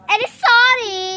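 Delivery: loud, grudging sorry; sarcastic sorry. Acted. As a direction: throw the apology back at them. Sarcastic shouted apology. (pabalang na sorry)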